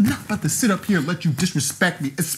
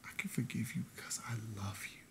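far softer, quick breathy whisper; whispered love to ex-fiancée; tender whisper; 8 semitones lower, 22 dB quieter, faster than usual. Acted. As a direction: forgive her gently, almost silent. Tender forgiveness.

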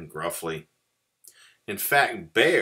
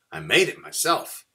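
higher, louder little mouse; proud mouse; 7 semitones higher, 6 dB louder than usual. A narrator's reading. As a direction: show off your own cooking. Mouse proudly presents his soup.

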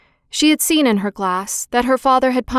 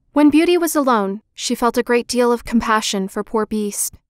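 ordinary delivery, nothing marked

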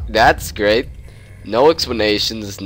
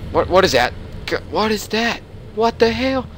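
higher, panicked questions at the creature; freaked-out squeal; 9 semitones higher than usual. Real, not acted. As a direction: shriek it in disbelief. Creature twitching on screen.

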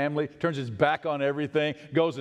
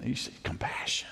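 whispered answer, much softer; 3 semitones lower, 8 dB quieter than usual. Real, not acted. Preacher whispering the answer to his congregation. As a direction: breathe the word out. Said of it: "whispered answer".

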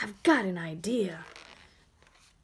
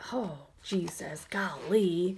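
unvoiced startled whisper; hushed oh golly; 4 semitones lower, 5 dB quieter than usual. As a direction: gasp it under your breath.

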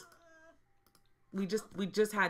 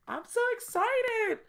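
high-pitched squeal of excitement, much louder; 8 dB louder than usual. Real, not acted. Gamer squealing at her newborn Sim baby. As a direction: shriek it like you can't believe it.

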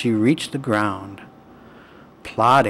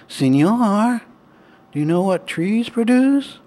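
higher, louder small animal voice; high porcupine voice; 10 semitones higher, 4 dB louder than usual. A narrator's reading. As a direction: squeak it as a little porcupine. Porcupine voice, second question.

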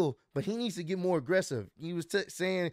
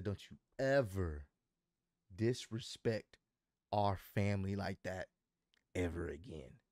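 quiet menacing warning; 10 semitones lower, 9 dB quieter than usual. Retold or imitated. Wrestler warns rival before slapping him, low quiet threat. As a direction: imitate a menacing warning, dropping low and soft.

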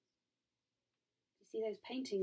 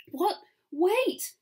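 high, louder cry of alarm; startled gasp; 10 semitones higher, 6 dB louder than usual. A narrator's reading. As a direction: gasp it out in surprise. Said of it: "I gasp".

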